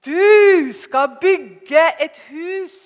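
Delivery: preacher hammering the promise, slow and loud; slow loud emphasis. Acted. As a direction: drive each word home.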